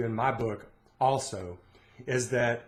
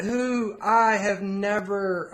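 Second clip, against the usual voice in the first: mock grumbling student; 7 semitones higher, much slower than usual. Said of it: "It's like".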